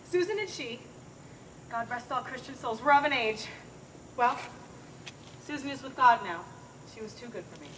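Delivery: low, very soft remembrance of the dead; hushed mourning. Acted. Nurse recalling her dead Susan, hushed mourning. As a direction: let the voice sink, softly grieving.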